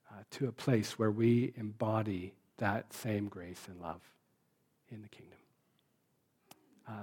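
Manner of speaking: prayer. Acted. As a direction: pray humbly, letting the voice sink to almost nothing. Pastor leads closing prayer.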